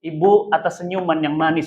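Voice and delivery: soft, tender reading of a farewell letter; a lightly voiced, tender farewell note. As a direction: read it gently, like a letter from a child. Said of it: "itu bacanya indah benar".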